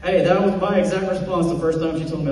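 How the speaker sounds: ordinary talk, nothing marked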